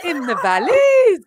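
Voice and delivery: drawn-out mock Welsh accent. Co-host playing with regional voices, slow mock Welsh accent. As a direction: stretch it out in a mock Welsh lilt. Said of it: "I've got a better Brummie accent".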